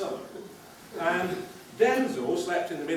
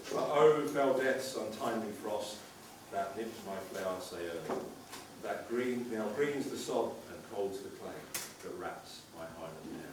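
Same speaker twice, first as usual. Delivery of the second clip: solemn poetry reading; poem recitation; 10 semitones lower, 6 dB quieter than usual. Acted. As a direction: recite the verse with reverence. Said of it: "one has to quote".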